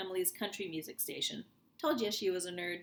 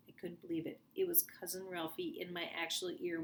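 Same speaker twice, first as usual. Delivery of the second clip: usual speaking voice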